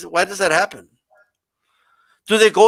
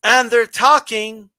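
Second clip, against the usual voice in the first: slow, pausing, wooden line reading; mock the memorized promo delivery again, slowly; stiff scripted promo talk; slower than usual. Shown as a demonstration. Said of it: "like this".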